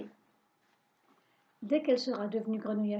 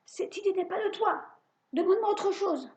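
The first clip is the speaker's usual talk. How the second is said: high, loud furious witch; witch's cry of rage; 8 semitones higher, 3 dB louder than usual. A narrator's reading. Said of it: "Elle pousse un cri de rage". (sorcière furieuse, voix aiguë et forte)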